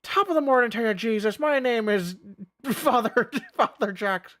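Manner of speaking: high mock Irish brogue, breaking into laughter; jolly hammed-up Irish priest.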